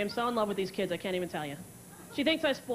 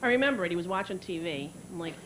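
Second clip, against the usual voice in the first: ordinary talk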